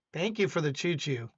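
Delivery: normal talking voice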